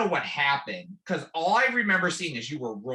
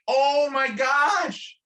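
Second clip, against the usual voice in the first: slow, much higher shouted gasp of alarm; 11 semitones higher, 5 dB louder, much slower than usual. Retold or imitated. Spotting the cliff where the friend fell, shocked slow shout. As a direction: draw it out in shocked dismay.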